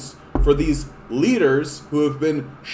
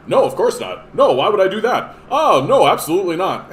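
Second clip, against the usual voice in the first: mock denial; 4 semitones higher, 6 dB louder than usual. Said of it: "he fucking did all that".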